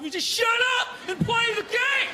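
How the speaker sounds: high, shouted mock rage; mock angry yell. Angry fan shouting at Terrell on TV, mocking yell. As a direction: yell like a furious sports fan.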